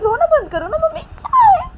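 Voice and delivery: very high, loud outburst; acted squealing complaint at full pitch; shrieked exasperation. A narrator's reading. Appealing to mother about Nisha's crying, exasperated shriek.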